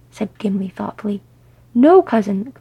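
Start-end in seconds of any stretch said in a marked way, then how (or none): 1.7-2.5 loud, urgent cry to his cousin; near shout. Acted. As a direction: raise the voice to stop him. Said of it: "Thorin all but shouted".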